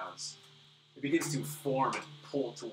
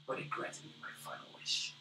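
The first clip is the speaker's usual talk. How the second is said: put-on faint, breathy whisper of a dying old man; feeble old man; 2 semitones lower, slower than usual. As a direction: whisper it like a frail, wounded elder. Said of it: "in his feeble breath".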